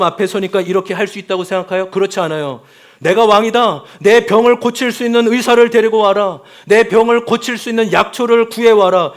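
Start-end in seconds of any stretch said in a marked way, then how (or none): 3.0-9.1 loud, higher commanding king; a haughty king demanding a doctor, loud; arrogant king. Acted. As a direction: bark orders like a proud monarch. Proud king giving orders voiced.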